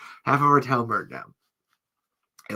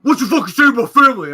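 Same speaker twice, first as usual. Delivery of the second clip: fast, high, shouted challenge; angry man impression; 11 semitones higher, 11 dB louder, faster than usual. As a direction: shout it in one rushed burst. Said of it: "but that's the impression".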